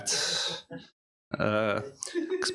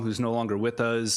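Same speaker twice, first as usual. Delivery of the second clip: usual speaking voice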